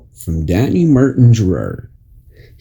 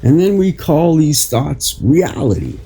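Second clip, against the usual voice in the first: inspirational reading; 4 semitones higher than usual. Acted. Reads an inspirational article aloud.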